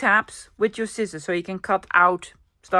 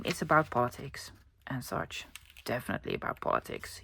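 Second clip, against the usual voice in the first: whispered aside; 9 semitones lower, 11 dB quieter than usual. Real, not acted. Aside about the magazine's politics.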